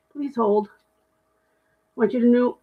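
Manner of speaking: normal talking voice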